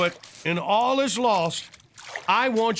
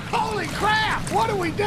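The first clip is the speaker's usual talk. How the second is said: panicked; 10 semitones higher than usual. Acted. Rogue wave approaching.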